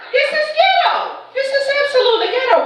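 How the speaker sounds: high, fast exasperated exclaim; mock outrage. Real, not acted. Comic telling the crowd about mama, high exasperated. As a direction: throw up your hands at it.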